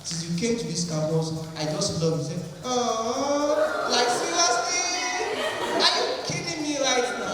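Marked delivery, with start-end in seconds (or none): 2.6-7.3 mock surprised girl. Girl voiced reacting to confession.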